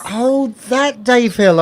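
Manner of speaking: light up as it clicks, slowly; sudden recognition. Acted.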